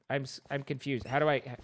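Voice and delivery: ordinary talk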